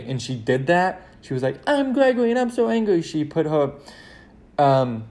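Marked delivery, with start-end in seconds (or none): 1.7-3.0 high mock angry brother; little sister mocking his anger, pitched high; sister mimicking him. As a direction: squeak it out like a kid sister teasing. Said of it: "can I do an impression of you?".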